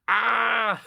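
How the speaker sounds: high delighted shriek; excited squeal. Real, not acted. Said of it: "I'm so excited for next episode".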